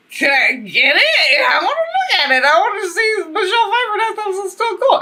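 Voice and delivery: high, fast, gushing plea; begging to see the old yearbook; eager excitement. Retold or imitated. Friend's garage yearbook, she begs excitedly. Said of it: "I'm like".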